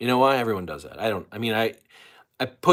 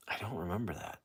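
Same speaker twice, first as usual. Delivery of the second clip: whisper; 2 semitones lower, 13 dB quieter than usual. Real, not acted. Aside to the chat.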